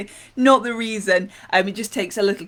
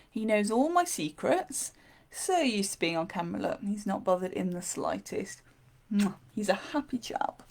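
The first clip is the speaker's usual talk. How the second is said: ordinary delivery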